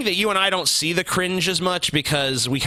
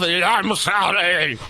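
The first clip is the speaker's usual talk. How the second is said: mock Scotty impression; 6 semitones higher, 3 dB louder, slower than usual. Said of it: "Such a gifted impressionist".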